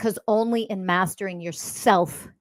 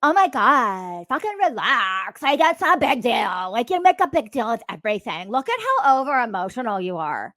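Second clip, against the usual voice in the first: mock dismissive friend; 2 semitones higher than usual. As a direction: exaggerate a friend brushing it all off. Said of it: "one of your friends being like".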